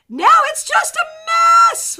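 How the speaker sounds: high, loud mock-despair cry; mock dramatic wail. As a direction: cry out in exaggerated dismay. Mock despair at messy cards.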